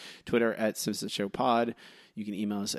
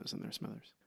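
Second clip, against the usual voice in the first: whisper; 14 dB quieter than usual. Acted. Burns voice whispered aside.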